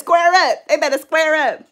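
loud, high hype challenge; mock tough threat. Telling Bree she will fight her dream bullies, mock tough. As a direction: call them out, loud and ready to fight. Sister defending in retold text.

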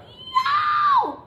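loud, very high yell; piercing high "Yow!"; high-pitched yelp of pain. A narrator's reading. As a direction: shriek from biting on a sore tooth. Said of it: "He took a bite and yelled".

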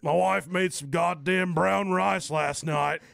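slow, loud mock Southern complaint; southern accent. Mock Southern guy grumbles about his wife's cooking. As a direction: drag it out like a grumpy Southern husband. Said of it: "when you use a southern accent".

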